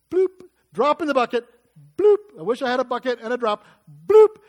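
high, loud playful bloop; drop sound effect. Shown as a demonstration. Imitates a drop falling.

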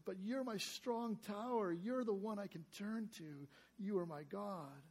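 soft, quiet trust; submissive trust. Acted. David submitting to God, soft and trusting. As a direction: hush it like a humble surrender. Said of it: "he always submits".

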